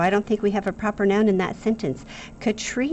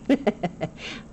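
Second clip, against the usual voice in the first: short, light chuckle; amused little giggle; 3 semitones higher, 3 dB quieter than usual. Real, not acted. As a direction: chuckle to yourself. Amused by the dog's name.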